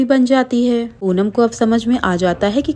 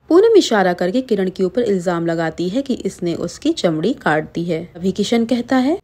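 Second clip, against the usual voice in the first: ordinary delivery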